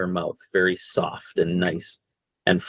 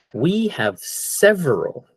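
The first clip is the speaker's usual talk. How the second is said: slowed down, each word spaced; slower; 7 semitones higher, slower than usual. Shown as a demonstration. Teacher to class, slowly and clearly. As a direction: say it slowly for learners. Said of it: "I'll go a little slower".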